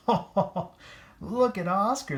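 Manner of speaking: high, slow jeering call; mocking dogs. A narrator's reading. Dogs jeering at Oscar, high and slow. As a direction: jeer at Oscar, stretched out. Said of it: "they cried".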